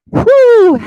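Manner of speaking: high, loud exhaled whew. Real, not acted. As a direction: blow out a big relieved whew. Exhale at plank's end.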